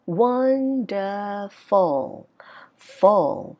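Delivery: "Wonderful" very slow, high WON, falling "Full". Shown as a demonstration. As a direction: hold WON up, slide "Full" down. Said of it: "nhấn trọng âm nó sẽ cao tông hơn". (đọc "Wonderful" nhấn cao đầu, xuống cuối)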